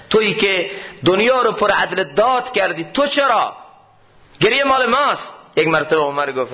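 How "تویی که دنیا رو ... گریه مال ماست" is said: impassioned plea. Retold or imitated. Ibn Abbas pleads with dying Umar, rising to an anguished cry. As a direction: pitch climbing much higher to a cry.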